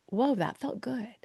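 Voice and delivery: soft, near-whispered amazement; hushed surprise. Retold or imitated. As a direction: breathe it out in quiet wonder. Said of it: "they're like".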